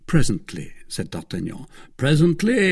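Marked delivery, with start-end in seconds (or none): 2.0-2.7 high, loud, affronted repeat; indignant echo. Acted. Athos objecting to D'Artagnan's putting him off, indignant echo. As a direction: throw the word back in protest.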